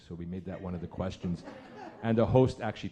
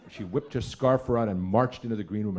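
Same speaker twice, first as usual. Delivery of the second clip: ordinary talk, nothing marked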